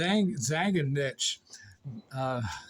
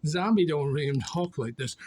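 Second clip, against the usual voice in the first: ordinary talk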